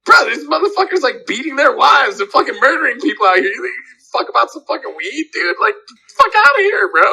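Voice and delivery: high-pitched, incredulous retold outburst; indignant rant. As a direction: act out a worked-up dismissal. Acted rant at those worried about cops.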